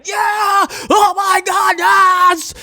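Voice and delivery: high excited cheering; mock crowd cheer. Imitating the ecstatic Saint Louis crowd, high and cheering. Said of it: "Saint Louis was ecstatic".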